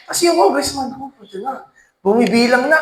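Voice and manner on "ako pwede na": much softer, a nervous little mutter; hushed nervous aside. Acted. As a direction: say it quietly to yourself, bracing. Congressman's aside. (mahinang kinakabahang bulong)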